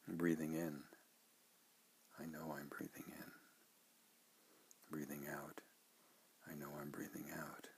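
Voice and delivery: soft, hushed breathing recitation; guided meditation. Shown as a demonstration. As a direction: murmur it gently, as if to yourself. Models meditation phrases.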